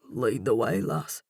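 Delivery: low Irish man's rumble; deep rumbling voice. A narrator's reading. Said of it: "That deep voice rumbles again".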